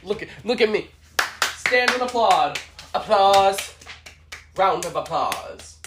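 slow, a hint of a grandma voice; grandma demanding applause. Grandma Josephine demanding applause, lightly voiced. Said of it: "my grandma Josephine".

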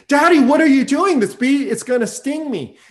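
high, loud outcry, easing toward the end; imitated panicked child. Frightened daughter to her dad as bee flies, imitated panic. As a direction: act out a little girl's alarmed protest.

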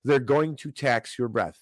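usual speaking voice

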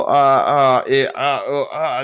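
mock flustered stammer; jumbled words. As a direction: stutter out repeated lost-for-words noises. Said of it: "jumbled my words".

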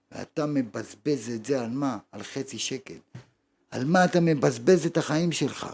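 usual speaking voice

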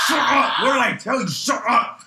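loud, high scolding yell; angry mock shouting. Cook yelling at his screaming coworker, angry shout.

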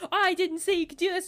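high, loud imitation of scolding; mock outraged parent. Mimics outraged controlling parent.

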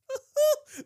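high, loud whooping laugh; short burst of preacher's delight. Real, not acted. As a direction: let out a gleeful high whoop. Preacher delighted by his own point.

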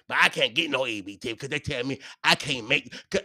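ordinary delivery

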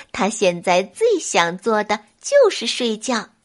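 plain reading voice

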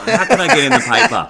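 high, loud, laughing send-up of a publicity seeker; giggling mock voice of a show-off; mock scheming friend. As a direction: lampoon him mid-laugh. Mocking a friend's thoughts.

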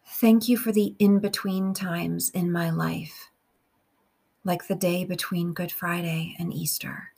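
slow, measured meditation with long pauses; guided prayer. Real, not acted. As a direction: pray phrase by phrase, unhurried, leaving room to repeat.

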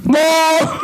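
normal talking voice

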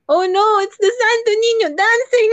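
high, laughing cry of alarm; laughing mock panic. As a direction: squeal it out in mock terror.

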